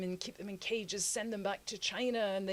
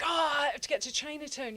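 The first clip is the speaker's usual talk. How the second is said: eager tourist; 8 semitones higher than usual. Retold or imitated. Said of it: "I was like".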